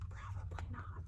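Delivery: hushed aside; whisper. Acted. Storyteller whispers an aside to the kids. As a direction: whisper it conspiratorially.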